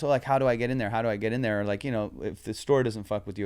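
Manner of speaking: ordinary delivery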